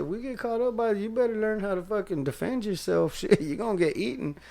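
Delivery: high, amused tough-talk to a friend; playfully bossy warning; mock tough advice. To a friend about attackers: high, amused tough talk. Telling her to defend herself.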